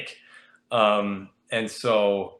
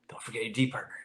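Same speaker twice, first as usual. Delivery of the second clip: murmured reminder; 9 dB quieter, faster than usual. Retold or imitated. Teammate murmuring during interview.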